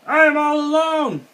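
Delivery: very high, loud panicked scream. A narrator's reading. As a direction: scream it as a toad who finds he is alone. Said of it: "screamed the Toad".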